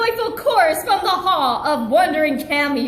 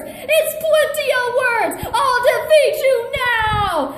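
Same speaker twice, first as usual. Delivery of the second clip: panicked protest; 7 semitones higher than usual. Acted.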